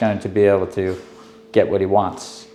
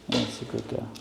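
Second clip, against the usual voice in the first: hushed, barely voiced praise; soft praise; 14 dB quieter than usual. Real, not acted. Trainer reassuring a new dog, hushed soft praise. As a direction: praise the dog almost under your breath.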